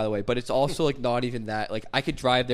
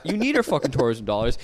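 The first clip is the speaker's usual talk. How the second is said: ordinary delivery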